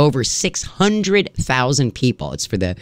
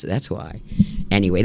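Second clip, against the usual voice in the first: ordinary talk, nothing marked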